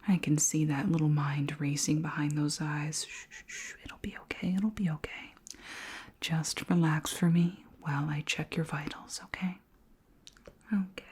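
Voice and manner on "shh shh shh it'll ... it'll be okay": gentle whispery shushing; hushed reassurance. Acted. Alien calms anxious human during examination, softly reassuring. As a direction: soothe a nervous patient under your breath.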